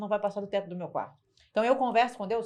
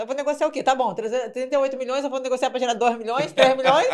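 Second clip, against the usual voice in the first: rising, louder disbelieving rant; exasperated outburst; 4 semitones higher, 9 dB louder than usual. Retold or imitated. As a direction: protest the absurd price, louder and louder. Retold heated objection about price. (desabafo exasperado, cada vez mais alto)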